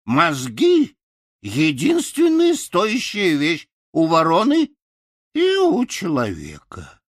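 high crow voice, lightly husky; husky crow advising. Acted. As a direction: give the crow's wise advice. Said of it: "хрипло сказала она мне". (ворона хрипло даёт совет)

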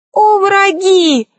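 high, drawn-out theatrical cry; wailing outcry. A narrator's reading. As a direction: cry out slowly, pitched high. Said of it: "возопил колдун".

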